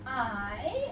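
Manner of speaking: "आई" drawn out slowly; long I sound. Shown as a demonstration. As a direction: stretch "आई" as a long vowel glide. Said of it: "इसके भी दो sound होते हैं". ("आई" को धीरे, लंबा खींचकर)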